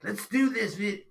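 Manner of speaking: loud, high, over-hyped mock greeting; too intense YouTuber. Dad mocking YouTuber voice for his son, too intense. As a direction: imitate an overexcited YouTuber. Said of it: "too intense".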